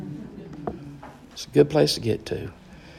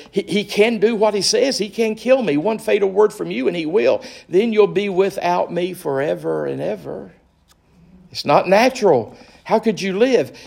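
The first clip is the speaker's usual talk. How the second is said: higher, wheedling, desperate creature voice; pleading lizard; 5 semitones higher than usual. Acted. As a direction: beg and coax like a clingy little lizard. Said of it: "the lizard began chattering to the ghost so loud".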